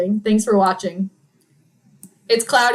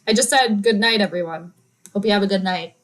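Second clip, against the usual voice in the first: ordinary talk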